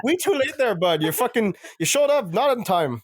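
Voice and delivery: mock French Canadian with broken phrasing; French Canadian accent. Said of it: "the weird French Canadian accent".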